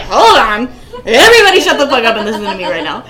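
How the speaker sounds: loud mock outburst, cutting everyone off; imitated yelling. Retelling his hallway outburst to the co-host, imitated yelling. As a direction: yell it like his hallway tirade. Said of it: "him yelling in that hallway".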